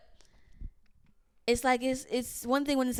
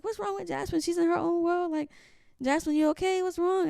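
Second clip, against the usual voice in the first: imitating worried family, higher and louder; mock worried onlookers, pitched up; concerned questioning; 8 semitones higher, 4 dB louder than usual. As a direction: ask after her with raised, louder concern.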